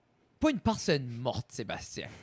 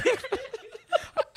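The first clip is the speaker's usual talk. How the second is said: big squealing laugh, pitched way up; high laugh. Real, not acted. Rire aigu après la blague. (grand rire aigu)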